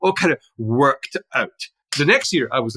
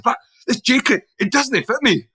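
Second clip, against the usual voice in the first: high, put-on Glaswegian voice, half-apologetic; attempted Glaswegian accent; 11 semitones higher than usual. Colleague complains the borrowed jacket doesn't fit, in a rough Glaswegian accent. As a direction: imitate a Glaswegian colleague, much higher. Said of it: "I can't exactly do his accent".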